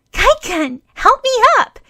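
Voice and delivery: very high, loud call for help; a girl calling for help climbing in. A narrator's reading. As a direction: call up from below the window.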